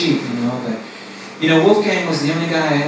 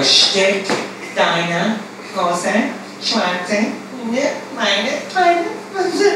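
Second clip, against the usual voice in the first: higher, halting repetition; girl repeating it; 6 semitones higher, 4 dB quieter, much slower than usual. Retold or imitated. Girl parroting the pimp's phrase, high and halting. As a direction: repeat it back high and unsure. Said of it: "we'd have to go".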